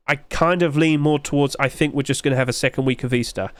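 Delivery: ordinary talk, nothing marked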